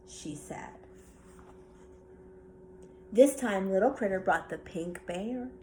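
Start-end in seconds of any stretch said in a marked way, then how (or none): none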